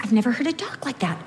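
usual speaking voice

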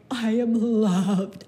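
slow, tender self-talk; touched. Retold or imitated. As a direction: savour it slowly, moved. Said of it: "you're like".

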